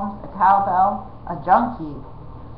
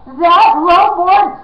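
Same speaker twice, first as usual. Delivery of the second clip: high, loud slow bellow; 9 semitones higher, 10 dB louder, much slower than usual. A narrator's reading. Prince bellows at Jemmy in outrage. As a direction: roar it in royal outrage. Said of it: "he bellowed".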